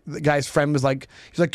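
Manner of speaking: normal talking voice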